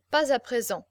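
ordinary delivery, nothing marked

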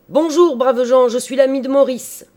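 loud, high shouted greeting; very loud cry. A narrator's reading. Visitor on the threshold greeting the startled household, shouting loudly. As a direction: call it out heartily to the whole room. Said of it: "en criant bien fort".

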